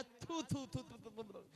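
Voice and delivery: repeated spitting sounds; mock spitting thoo thoo; spitting out tea. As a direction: spit out the bad tea. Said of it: "मैंने कहा".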